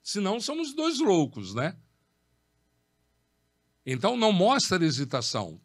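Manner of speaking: ordinary delivery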